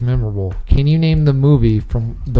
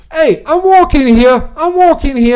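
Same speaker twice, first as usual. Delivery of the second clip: much higher, louder New York yell; New York tough guy; 12 semitones higher, 3 dB louder than usual. Acted. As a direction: shout it at a taxi like a street hustler. Acting out Midnight Cowboy line.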